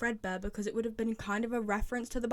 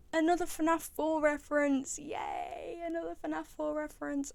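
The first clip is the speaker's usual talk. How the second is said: delighted cheer; 6 semitones higher than usual. Real, not acted. Delighted by a game reference.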